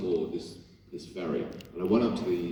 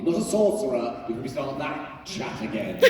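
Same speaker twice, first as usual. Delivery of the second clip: ordinary talk, nothing marked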